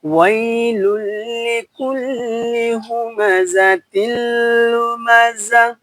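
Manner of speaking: slow, high melodic chant, vowels stretched long; teacher chanting the full verse for students to follow; Quran recitation. Shown as a demonstration. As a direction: sing the whole verse out like a reciter. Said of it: "খেয়াল করুন।".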